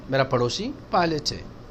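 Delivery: usual speaking voice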